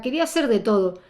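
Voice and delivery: ordinary talk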